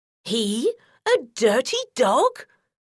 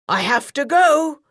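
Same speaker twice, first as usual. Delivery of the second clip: louder rushed wolf voice; wolf fleeing the hunters, louder; hurried wolf; 3 semitones higher, 5 dB louder than usual. A narrator's reading. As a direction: blurt it like a wolf in a panic.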